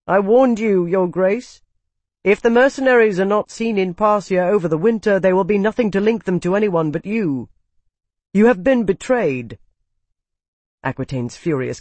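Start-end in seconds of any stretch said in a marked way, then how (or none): 0.0-9.6 higher, louder, urgent accuser; accusing Calyx, agitated. Acted. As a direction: press the warning with heated alarm. Voices an alarmed, accusing Calyx.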